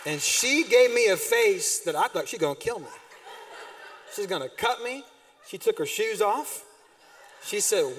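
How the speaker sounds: ordinary talk, nothing marked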